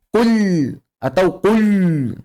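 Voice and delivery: "kul" with an exaggerated thick, held l; wrong kul. Shown as a demonstration. Teacher warning students, exaggerated thick-l "kul". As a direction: drag "kul" out on a heavy dark l. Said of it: "Jangan dibaca".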